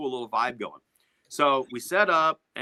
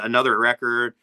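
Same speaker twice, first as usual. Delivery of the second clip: ordinary talk, nothing marked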